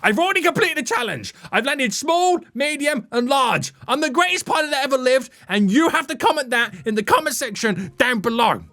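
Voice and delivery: high, fast, cocky bragging. Real, not acted. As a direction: brag it to the viewers. Bragging to viewers.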